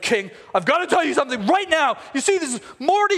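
high, worked-up announcement; hammed-up eager tattling; urgent blurting. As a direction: burst in with urgent news for the king. Haman bursting in with urgent news.

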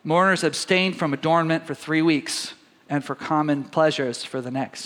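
ordinary delivery

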